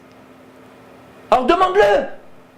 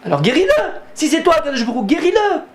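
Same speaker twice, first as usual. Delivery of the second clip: excited challenge; 9 semitones higher than usual. Real, not acted. Preacher challenging, rhetorical plea.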